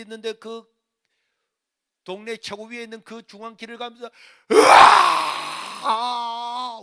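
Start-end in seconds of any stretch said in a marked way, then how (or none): 4.5-6.8 long loud crying wail. Retold or imitated. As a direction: wail while walking up the village road. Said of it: "울면서 가니까요".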